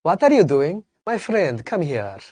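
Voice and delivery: lightly imitated African accent; a friendly, playful African accent. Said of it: "আফ্রিকানরা বলে". (হালকা African উচ্চারণে নকল)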